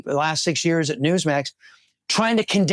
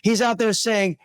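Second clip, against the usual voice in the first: ordinary talk, nothing marked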